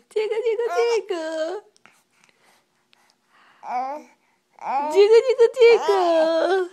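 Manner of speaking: high squealing tickle chant; giggly tickle attack. Acted.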